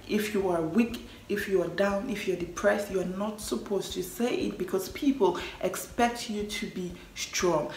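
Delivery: usual speaking voice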